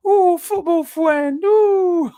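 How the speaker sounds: high, slow, cooing put-on voice; a lightly mocking sing-song; film character voice. Quoting In-Betweeners again.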